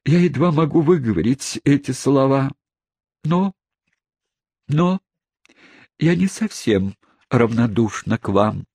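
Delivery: high, halting, bashful lady; bashful confession. Acted. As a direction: confess shyly, hesitating. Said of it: "прошептала незамужняя тётушка".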